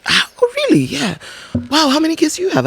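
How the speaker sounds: high exaggerated amazement; mock impressed. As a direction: gush it like you're impressed. Voicing impressed reply.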